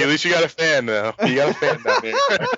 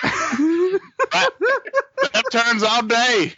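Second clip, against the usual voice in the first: higher, slow, hammed-up driver quip; Dale Junior impression; much slower than usual. Friend ends the racing-driver impression with a quip. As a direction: drawl it out like a racing driver joking. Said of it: "my Dale Junior impression".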